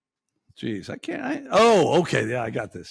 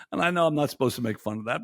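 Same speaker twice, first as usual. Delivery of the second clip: ordinary delivery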